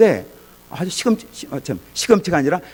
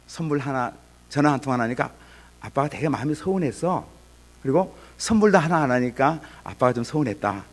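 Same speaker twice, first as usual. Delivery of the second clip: gentle I-message; 4 semitones lower than usual. Shown as a demonstration. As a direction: tell your child gently that you were hurt. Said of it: "이렇게 얘기하는 거예요".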